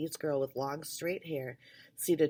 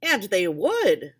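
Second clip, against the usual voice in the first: put-on insistent boy; 4 semitones higher, 11 dB louder, slower than usual. A narrator's reading. Sausage boy insists, slow loud.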